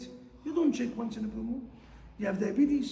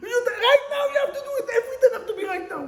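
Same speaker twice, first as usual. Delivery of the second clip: high, loud, fast demanding wife; mock-shrill nagging wife, laughing at the end; impatient wife; 13 semitones higher, 8 dB louder, much faster than usual. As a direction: rattle it off like a wife who wants it done now. Wife demanding immediate action acted.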